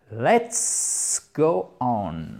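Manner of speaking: drawn-out, word-by-word cue; slow, deliberate move to the next item. Shown as a demonstration. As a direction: stretch each word right out.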